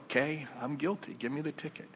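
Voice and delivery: resigned admission. Retold or imitated. Driver admits guilt to the officer, quietly resigned. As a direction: give in quietly, voice dropping.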